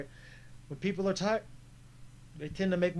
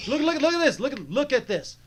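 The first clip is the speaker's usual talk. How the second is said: high, louder insistence on the replay; urgent excited look; 7 semitones higher, 3 dB louder than usual. Real, not acted. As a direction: call attention urgently, pitched up.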